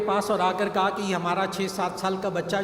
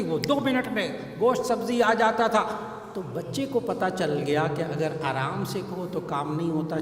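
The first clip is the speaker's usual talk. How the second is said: usual speaking voice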